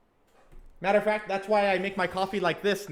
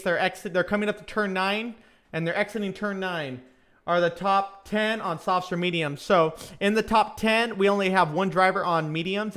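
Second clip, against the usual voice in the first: ordinary talk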